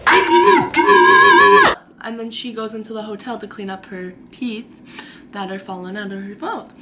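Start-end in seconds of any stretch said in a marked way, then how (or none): 0.1-1.8 loud, drawn-out high scream; mock yelling. April screaming at lobby staff, drawn out. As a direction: imitate an all-out slow yell.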